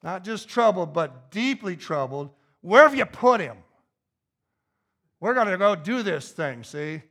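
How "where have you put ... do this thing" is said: loud, forceful demand, starting high; angry, determined Jesus. Acted. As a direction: demand it, ready to take charge. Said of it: "That's the attitude he's got".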